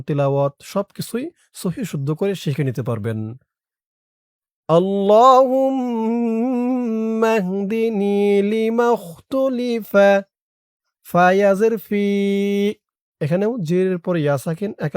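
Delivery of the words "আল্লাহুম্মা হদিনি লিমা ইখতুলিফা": drawn-out, melodic dua chanting; slow Quranic-style recitation of a prayer; slow Arabic recitation. Shown as a demonstration. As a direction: recite each syllable long and lilting.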